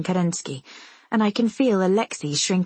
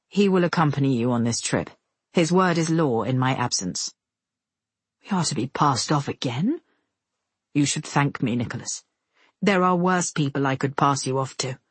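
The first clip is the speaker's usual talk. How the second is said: a hint of a stern official, pitched lower; lightly voiced Kerensky; 4 semitones lower than usual. Kerensky tells Nicholas about the escort, lightly voiced lower authority.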